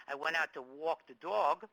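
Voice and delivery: a natural New York metro accent on walk and dog. Shown as a demonstration. Teacher showing his own New York accent to the class, New York accent. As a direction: say it like a born New Yorker. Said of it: "The fact that I might say, coming from the New York metropolitan area".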